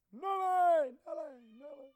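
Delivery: high call dying away in echoes; fading echo. Shown as a demonstration. Showing how the shout carries in the open, fading echo. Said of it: "You know, the echo".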